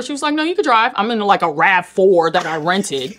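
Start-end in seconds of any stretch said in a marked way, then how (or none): 0.4-0.9 Serena's breezy reply. Retold or imitated. Voicing Serena in the retold story.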